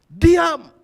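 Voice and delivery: sudden high, full-voiced yell; loud shout. Father losing patience with his brawling children, loud shout. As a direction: explode in one sharp shouted command. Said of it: "saya mulai bersuara keras".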